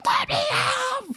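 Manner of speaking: high panicked mock cry of a locked-in kid; mock scream. Friend mimics the kid locked in the trunk, high panicked squeal. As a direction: squeal it out high like a trapped boy. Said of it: "Screaming, like".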